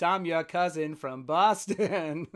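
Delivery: slow, broad Boston accent, R's dropped. Shown as a demonstration. Host showing his own attempt for co-hosts, hammed-up Boston accent. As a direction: butcher a Boston accent, drawing it out. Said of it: "butcher the Boston accent".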